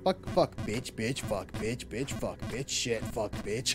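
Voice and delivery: low, soft string of rhythmic curses; quiet swearing spree. Real, not acted. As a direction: rattle off the swears under your voice. Getting swearing out before no-swear timer.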